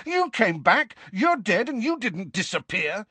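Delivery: high, accusing protest; angry. A narrator's reading. Harry accuses the ghost of coming back, angry. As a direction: argue back angrily, pitched high. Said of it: "said Harry angrily".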